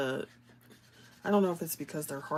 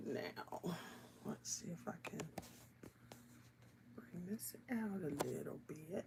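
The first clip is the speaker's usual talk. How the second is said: whispered aside to herself; soft murmur under the breath; 13 dB quieter than usual. Real, not acted. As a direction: hush it down while working. Quiet aside while coloring.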